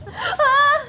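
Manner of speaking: high, loud yelp of fright; startled scream. Real, not acted. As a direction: shriek suddenly in fear. Startled by noises in the dark.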